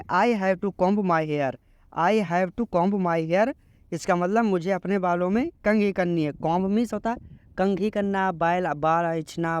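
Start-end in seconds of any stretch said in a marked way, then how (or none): 0.0-3.5 very slow, each English word laid out clearly; slow teaching read. Shown as a demonstration. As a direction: model the sentence slowly for learners. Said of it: "English नहीं पता होता है".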